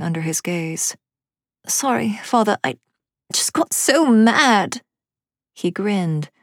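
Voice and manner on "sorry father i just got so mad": put-on high-pitched child's apology, swelling at the end; sheepish little girl. A narrator's reading. Amelia to her father after burning the book, sheepish. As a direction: apologize small, then burst out.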